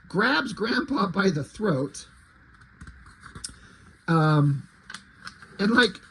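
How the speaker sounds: normal talking voice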